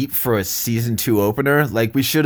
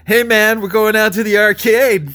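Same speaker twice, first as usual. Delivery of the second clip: loud, higher dude-bro voice; 10 semitones higher, 6 dB louder than usual. Pretend. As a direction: play a hyped-up guy friend. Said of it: "Do a guy one".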